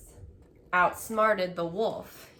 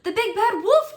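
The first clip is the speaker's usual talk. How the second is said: high, loud alarmed cry; frightened cry; 13 semitones higher, 7 dB louder than usual. A narrator's reading. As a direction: shout the warning in a panic. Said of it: "cried the super pig".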